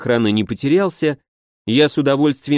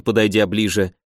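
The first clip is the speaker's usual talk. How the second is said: ordinary delivery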